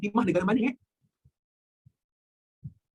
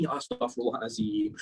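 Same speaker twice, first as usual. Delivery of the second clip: low muttered astagfirullah; 11 semitones lower, 5 dB quieter than usual. Real, not acted. Teacher exclaims at odd question.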